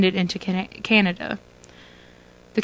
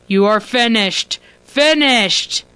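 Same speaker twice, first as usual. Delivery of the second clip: booming villain voice building louder; rumbling voice; 3 semitones higher, 5 dB louder, slower than usual. Acted. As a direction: rumble louder on each finished. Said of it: "The voice grows louder and louder".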